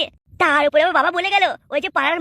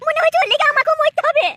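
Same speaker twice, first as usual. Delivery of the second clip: put-on lamenting young wife; 8 semitones higher than usual. Wife laments to herself about her husband, high and complaining.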